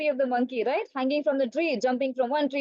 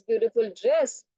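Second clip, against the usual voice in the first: ordinary talk, nothing marked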